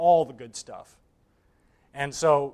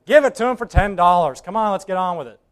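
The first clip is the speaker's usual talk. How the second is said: impatient call; 3 semitones higher, 9 dB louder than usual. Acted. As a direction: wave it through, annoyed. Bidder hurries the sale.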